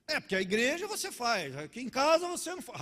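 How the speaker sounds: high, loud mock accusation; accusing wife. Mimics wife's accusation in quarrel.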